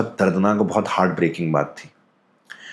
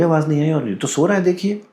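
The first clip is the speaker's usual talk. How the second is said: put-on higher, insisting the boy is asleep; father insisting; 8 semitones higher than usual. Father, a bit higher, insisting his son is asleep. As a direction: wave it away, the child is sleeping. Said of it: "कह रहे".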